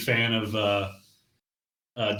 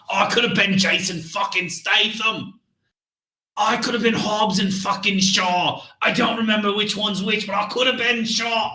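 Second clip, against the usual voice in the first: British soldier impression. Podcast host doing the movie's British agent, ranting in a British accent. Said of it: "British S A S agent".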